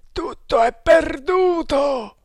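high, slow drawn-out shout; despairing yell. A narrator's reading. Thug watching the frigate escape, despairing yell. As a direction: yell it high, stretching every word. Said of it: "urlò uno di loro".

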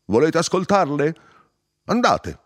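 loud, high-pitched urging; shouting. A narrator's reading. Narrator sends them to hear the madmen, shouting. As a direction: shout the repeated orders, insistent.

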